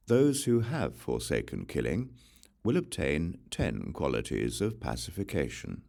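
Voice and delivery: plain reading voice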